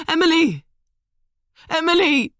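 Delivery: loud high desperate cries; shouting her name. A narrator's reading. Liam shouting Emily's name at the phone. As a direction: call her name, frantic.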